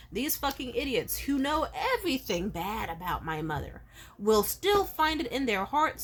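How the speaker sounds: usual speaking voice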